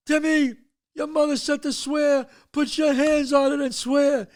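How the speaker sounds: high, urgent order; father screaming. Retold or imitated. Father orders Timmy to swear, high insistent yelling. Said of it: "They're all screaming this".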